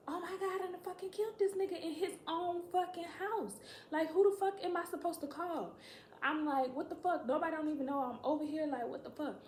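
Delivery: high-pitched, panicked reenactment; high, frantic disbelief, played for the story; acted panic. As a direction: act out a rising panic. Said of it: "So I just started panicking".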